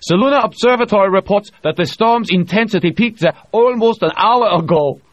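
Diels voiced. A narrator's reading. Diels reports storm news to the crew, excited. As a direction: share the relief with the room.

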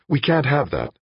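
high, sharp objection. A narrator's reading. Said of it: "she said sharply".

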